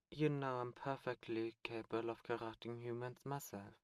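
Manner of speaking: ordinary delivery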